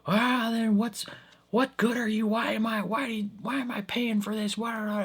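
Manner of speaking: high, whiny complaining customer voice; whiny old customer. Retold or imitated. Old customer whining at the help desk, high and whiny. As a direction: whine like a cranky old customer.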